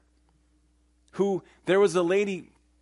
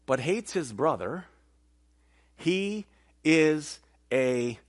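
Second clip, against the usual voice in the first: very slow, measured King James reading; solemn memorized verse, slow; scripture recitation; 4 semitones lower, slower than usual. Acted. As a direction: let each phrase land with long pauses.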